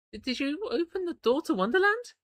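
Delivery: high, anxious question; frightened question. Acted. Iggy asking Bucks, high and anxious. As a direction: ask it high and afraid.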